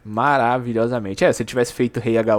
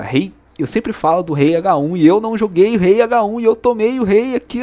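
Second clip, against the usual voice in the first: frustrated yell; 8 semitones higher, 5 dB louder than usual. Retold or imitated. Streamer reliving a painful chess loss, crying shout. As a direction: voice climbing high into a crying shout. Losing the king, yelling in frustration.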